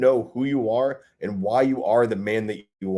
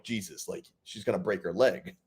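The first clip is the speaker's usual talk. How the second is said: inner worry; 4 semitones lower, 8 dB quieter than usual. Retold or imitated. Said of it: "even though I'm like".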